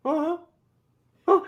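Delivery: high, short acknowledging hums; noncommittal listening. Acted. Spouse refusing to argue back, noncommittal listening. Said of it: "And you're just going".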